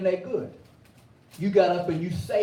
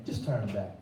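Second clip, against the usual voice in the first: quiet plea; 8 semitones lower, 6 dB quieter than usual. Retold or imitated. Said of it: "This man saying".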